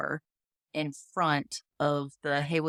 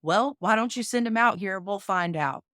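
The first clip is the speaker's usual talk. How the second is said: louder and higher, a retold bully's challenge; mock tough guy; 5 semitones higher, 5 dB louder than usual. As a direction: taunt him like a thug at the door. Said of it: "And the guy's like".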